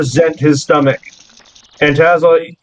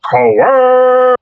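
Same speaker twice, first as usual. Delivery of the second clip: high, stretched-out taunt; jeering creature shout; 7 semitones higher than usual. Acted. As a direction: call it out like a wrestler mocking a fleeing foe. Game master voices creature taunting Jerry.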